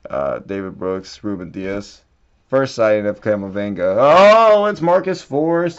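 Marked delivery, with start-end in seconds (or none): 4.0-5.8 sudden higher, louder gasp of surprise; light up at the find; surprised. Real, not acted. Spotting a numbered card in the pack, surprised.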